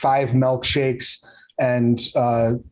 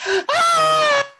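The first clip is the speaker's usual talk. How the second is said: very high, sustained shriek; hysterical scream. Acted. Car hop turns and looks at Alvin, high hysterical scream. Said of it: "She turns and looks at you and goes".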